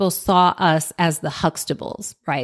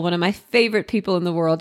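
usual speaking voice

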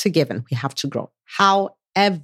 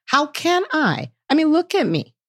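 self-doubting protest; 10 semitones higher than usual. Acted. Self-doubter answers God's calling, high incredulous protest. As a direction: plead unworthiness with the pitch jumping up. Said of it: "Which is what we say".

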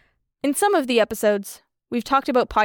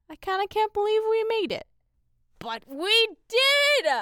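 high sing-song; 10 semitones higher, 4 dB quieter than usual. Real, not acted. Sing-song disbelief at ending.

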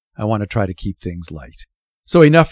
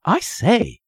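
put-on high-pitched boyish exclamation; eager, raised boy's voice; excited cry; 10 semitones higher than usual. A narrator's reading. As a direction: cry out in sudden interest. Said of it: "he cried".

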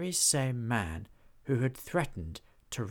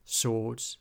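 ordinary delivery

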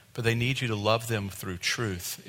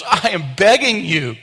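pleading; 7 semitones higher, 9 dB louder, slower than usual. Retold or imitated. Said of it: "He was just crying".

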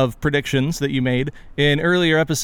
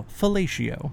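ordinary talk

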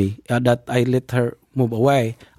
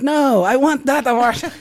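much higher, laughing as he quotes her; amused quote; 12 semitones higher than usual. Retold or imitated. Telling listeners her eager reply, laughing.

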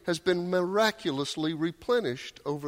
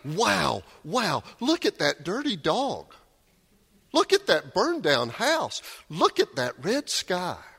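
high, wide-eyed wonder; childlike wonder; 5 semitones higher than usual. Retold or imitated. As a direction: marvel at everything like a small child. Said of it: "a child who's going".

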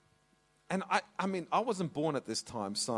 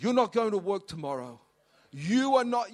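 firm order; 5 semitones higher, 8 dB louder than usual. Retold or imitated. Mum ordering dad.

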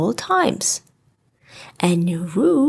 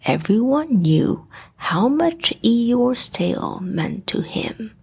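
ordinary delivery, nothing marked

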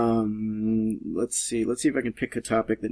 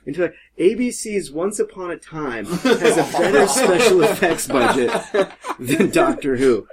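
laughing; 5 semitones higher, 6 dB louder than usual. Real, not acted. Laughing through a complaint about effects.

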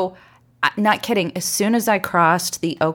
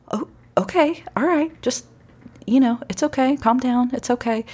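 soothing; 6 semitones higher than usual. Retold or imitated. Officer calming the crying woman, soothing. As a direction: settle her down gently. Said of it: "he's like".

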